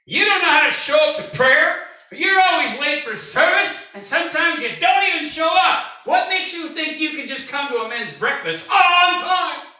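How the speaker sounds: high, loud calling-out of a late minister; retold scolding. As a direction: confront him half joking, voice up. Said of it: "I walked up and I said".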